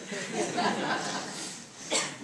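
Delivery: short breathy chuckle. Real, not acted. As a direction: laugh fondly at the memory. Father preferred friend's poetry.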